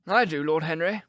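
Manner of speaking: plain reading voice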